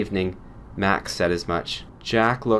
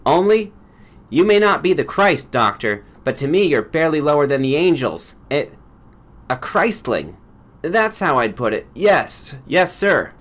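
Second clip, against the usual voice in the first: louder, higher, adoring insistence; fervent tax lawyer; 6 semitones higher, 6 dB louder than usual. Acted. Hard-bitten lawyer insists the doctor is almost holy, louder and fervent. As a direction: proclaim your devotion to him.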